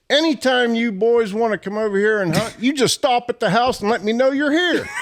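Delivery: high, laughing telling of the landowner's words; landowner's offer. Retold or imitated. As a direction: pass on his friendly offer, amused. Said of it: "He says".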